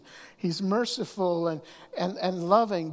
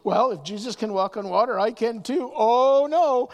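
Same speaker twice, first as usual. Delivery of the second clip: mock Peter; 4 semitones higher, 8 dB louder than usual. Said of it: "doing something before he thinks".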